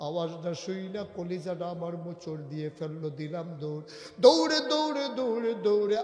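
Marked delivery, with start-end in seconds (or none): 4.2-6.0 high, loud repeated outburst easing slightly; urgent cry. Acted. Preacher telling of Umar running to the sound, urgent cry. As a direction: shout the running, word upon word.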